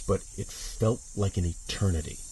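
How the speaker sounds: ordinary delivery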